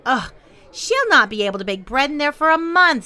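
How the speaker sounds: high, loud complaint; groan, then protest loudly in a high voice; disgusted neighbor woman. A narrator's reading. Madame Roy judging the oven, disgusted.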